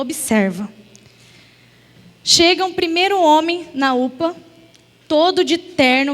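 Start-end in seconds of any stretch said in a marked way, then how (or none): 0.0-0.7 low, hushed divine command; God's voice. Retold or imitated. God speaks to her heart, a low hushed command. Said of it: "porque Ele falou".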